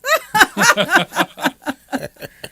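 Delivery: a burst of real laughter; laughing. Real, not acted. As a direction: laugh out loud at the joke. Host laughs at joke.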